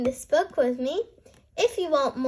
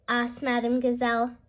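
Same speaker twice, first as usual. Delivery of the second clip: ordinary delivery, nothing marked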